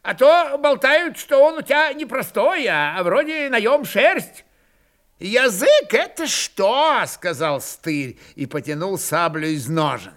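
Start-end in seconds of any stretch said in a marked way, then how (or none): 0.0-4.4 high-pitched, sly teasing; mock-serious curiosity. A narrator's reading. Kondrat baits the old man with a rumour, mock-curious. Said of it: "изобразил на лице серьёзное любопытство". 5.2-7.0 high, slow, drawn-out challenge; menacing retort. A narrator's reading. Goaded old Cossack draws his sabre, menacing.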